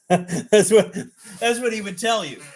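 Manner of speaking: high-pitched, laughing through the words. Real, not acted. Streamer amused by his own impression, laughing. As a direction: crack up while talking.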